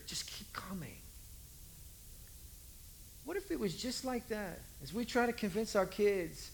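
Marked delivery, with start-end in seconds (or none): 0.1-1.0 soft whispered urging. Acted. Jesus whispers his last urging, hushed.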